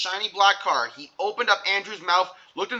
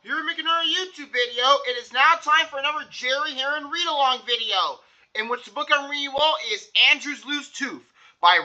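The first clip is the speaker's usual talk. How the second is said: raised, bright announcer opening; upbeat intro; 6 semitones higher than usual. Acted. Host greeting his YouTube viewers, upbeat announcer.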